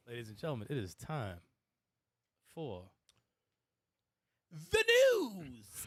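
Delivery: mock announcer. Show host introduces news segment.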